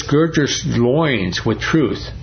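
"Gird your loins with truth" drawn out at half pace, every word weighed; slow scripture quote. Real, not acted. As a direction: read the verse slowly and solemnly. Quoting scripture for emphasis.

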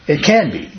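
"can" pushed up and hit hard in "it can be"; stress on CAN. Shown as a demonstration. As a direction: lean on the word "can". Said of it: "You should emphasize that it can be".

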